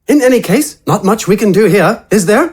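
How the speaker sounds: relishing the idea of explosives; Wither's voice. Acted.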